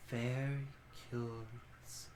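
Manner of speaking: very soft, slow musing; hushed intrigued creature; ancient creature voice. Acted. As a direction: breathe it out with interest.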